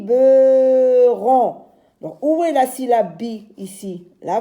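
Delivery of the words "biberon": "biberon" very slow and loud, each syllable drawn out; syllabes étirées. Shown as a demonstration. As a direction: stretch "biberon" into bi, be, ron. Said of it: "moi, je dis". (« biberon » découpé lentement en syllabes, voix forte)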